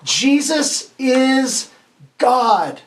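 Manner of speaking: very slow drawn-out "Jesus is", then "God" landing firmly; slow dramatic reveal for the kids; emphatic reveal. Shown as a demonstration. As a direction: build suspense, then land "God". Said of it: "Here's the answer".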